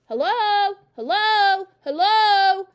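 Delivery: very loud, high parrot calls; parrot calling out. A narrator's reading. Parrot calling down the chimney to Matilda, loud calling. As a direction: call down like a talking bird. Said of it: "the bird called down to her".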